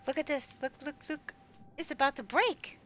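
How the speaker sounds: gasping, high-pitched rush of alarm; urgent look-look-look at the screen; excited. Real, not acted. Streamer spots her fishing lure about to snap, gasping excitedly.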